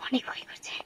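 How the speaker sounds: frightened breathy whisper; scared whisper. Real, not acted. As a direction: whisper it, afraid of being heard. Scared vlogger in haunted house.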